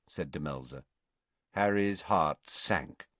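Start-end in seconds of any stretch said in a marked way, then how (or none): none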